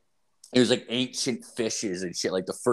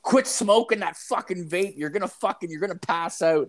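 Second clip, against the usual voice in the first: put-on nagging girlfriend; 7 semitones higher than usual. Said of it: "My girlfriend's like".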